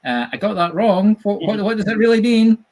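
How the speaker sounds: high, loud, puzzled asking; polite puzzled question. Retold or imitated. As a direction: politely ask what went wrong. Asking Spirit politely, acted.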